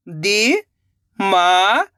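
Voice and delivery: very slow, stretched syllables, rising at the end; sounding out syllables. Shown as a demonstration. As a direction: sound it out slowly, letter by letter. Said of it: "एक एक अक्षर का उच्चारण करके".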